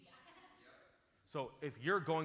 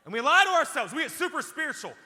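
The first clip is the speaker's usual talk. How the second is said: much higher and louder, ringing out; preaching shout; 12 semitones higher, 13 dB louder than usual. Real, not acted. Preacher calling out self-deception, loud and high. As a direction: cry it out to the congregation.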